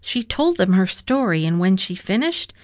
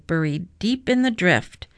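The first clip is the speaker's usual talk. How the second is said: usual speaking voice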